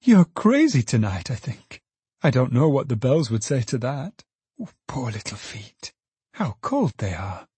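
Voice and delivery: tender daughter, tutting over cold feet; gentle daughter Meg. Acted. Meg's voice, daughter.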